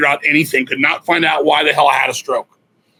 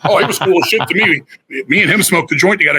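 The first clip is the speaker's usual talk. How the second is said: ordinary delivery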